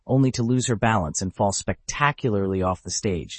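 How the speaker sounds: plain reading voice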